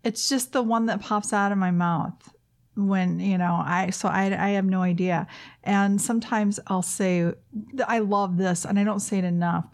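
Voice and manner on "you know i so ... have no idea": ordinary talk, nothing marked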